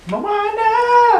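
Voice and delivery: high, loud shout of the name; excited shout. Real, not acted. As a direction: call it out with delight. Calling out the name.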